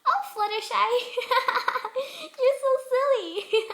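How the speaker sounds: high, loud, laughing through it; cracking up at a friend. Acted. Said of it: "snorted and almost fell over on her side in laughter".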